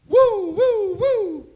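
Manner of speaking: high, loud wailing siren; siren imitation. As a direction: wail it out like a police siren.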